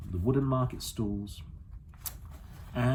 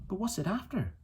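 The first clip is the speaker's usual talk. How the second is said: a hint of a curious girl, asking; a girl pressing the old man for answers; lightly voiced young girl; 10 semitones higher than usual. A narrator's reading. Lyra asks Father Coram.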